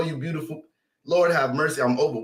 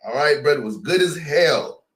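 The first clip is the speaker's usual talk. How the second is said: ordinary talk, nothing marked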